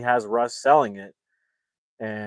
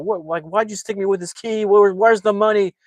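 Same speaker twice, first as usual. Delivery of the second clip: much higher and louder, demanding answers; pissed-off shout; 10 semitones higher, 6 dB louder than usual. Retold or imitated. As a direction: scream the questions at him. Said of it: "he's just pissed".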